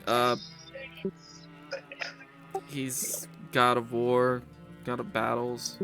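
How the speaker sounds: usual speaking voice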